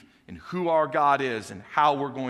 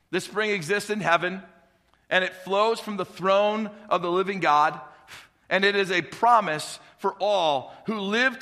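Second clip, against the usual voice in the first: preaching; 5 semitones higher than usual. Acted. Preaching builds on heaven's spring.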